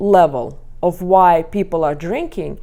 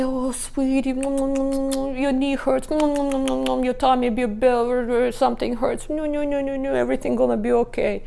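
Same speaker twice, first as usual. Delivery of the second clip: put-on higher cooing comfort to a toddler; higher soothing baby talk to fallen son; fussing mother; 6 semitones higher than usual. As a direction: coo over the hurt child, anxious and fast at the end.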